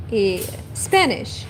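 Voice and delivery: normal talking voice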